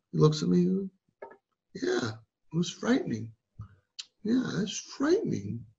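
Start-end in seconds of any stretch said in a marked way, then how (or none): 1.7-5.7 subdued agreement. Retold or imitated. Acting the man accepting sympathy.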